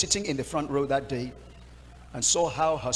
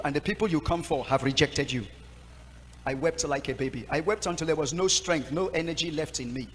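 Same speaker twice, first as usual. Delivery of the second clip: ordinary delivery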